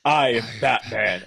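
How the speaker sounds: slow, deliberate Bale Batman voice; slowed mock Bale Batman growl. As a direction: drag out each word like Bale's Batman.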